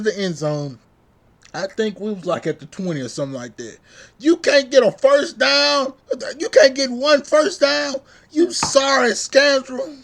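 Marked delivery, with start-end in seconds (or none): 4.2-5.9 loud, slow, higher incredulous yell; angry shout. Real, not acted. Fan yelling at quarterback, loud higher outraged shout. 6.4-10.0 loud, higher furious yell, slowing on the insult; angry shout. Real, not acted. Fan yelling at quarterback over his misses, furious loud shout.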